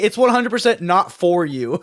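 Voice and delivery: loud, playful put-down; mock roast. Imagining the guest roasting the host, loud and teasing. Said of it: "a really funny way to roast me".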